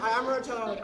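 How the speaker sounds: usual speaking voice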